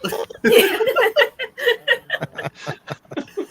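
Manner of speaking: long, high peals of laughter; high-pitched laughing fit. Real, not acted. Laughing at the Ace Ventura raccoon comparison, high helpless laughter.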